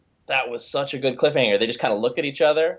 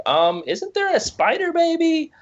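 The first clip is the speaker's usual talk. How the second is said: mock baffled fan; 12 semitones higher than usual.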